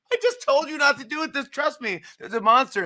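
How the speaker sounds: high, loud pleading Grover. Retold or imitated. Grover begging the reader not to turn, high pleading. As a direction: beg the reader like a panicked puppet. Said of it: "he's like".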